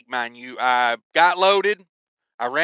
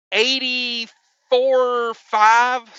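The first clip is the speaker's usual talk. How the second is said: stunned slow read; 6 semitones higher, much slower than usual. Retold or imitated. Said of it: "I was like".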